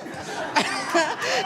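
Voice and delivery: real high-pitched laugh. Real, not acted. Laughing at the memory.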